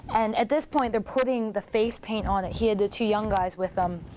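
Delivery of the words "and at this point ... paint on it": normal talking voice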